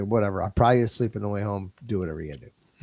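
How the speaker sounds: low, fast, shrugging it off; dismissive girlfriend. Retold or imitated. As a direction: brush it off lazily. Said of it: "she's like".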